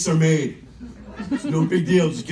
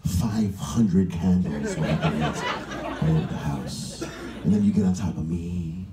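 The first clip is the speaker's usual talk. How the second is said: low, slow, breathy murmur; hammed-up steamy movie lover, fading to whisper; mock seductive; 9 semitones lower, 3 dB quieter, slower than usual. Lover describes candlelit fantasy, mock seductive low voice.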